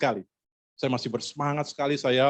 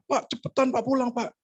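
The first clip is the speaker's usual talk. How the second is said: high-pitched nagging plea; imitating a caller rushing someone; urgent caller; 12 semitones higher than usual. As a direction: urge him along in a high voice. Caller keeps urging him home.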